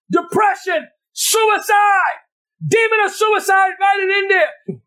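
normal talking voice

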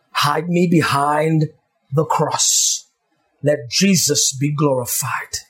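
a pastor praying low and hushed over his people; fervent prayer. Acted.

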